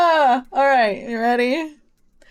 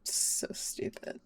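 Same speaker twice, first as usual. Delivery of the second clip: slow whispered grumble to self; annoyed whisper; 17 dB quieter, slower than usual. Real, not acted. Player grumbling over a tricked image, whispering. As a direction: whisper it under your breath, drawn out.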